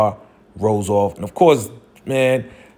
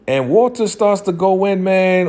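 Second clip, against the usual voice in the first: ordinary talk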